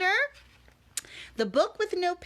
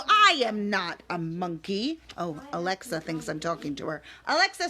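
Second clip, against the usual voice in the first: plain reading voice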